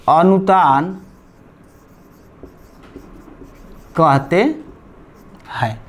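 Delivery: very slow, word by word with long pauses; dictation. Real, not acted. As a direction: dictate it for students writing it down. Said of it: "इसकी परिभाषा लिख लीजिए".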